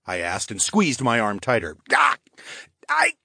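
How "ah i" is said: high, slow gasping voice. Acted. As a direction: gasp the words out under a chokehold. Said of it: "he gasped".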